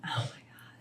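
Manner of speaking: whispered inward groan; barely voiced, retold exasperation; under-breath dread. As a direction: whisper it to yourself in dismay.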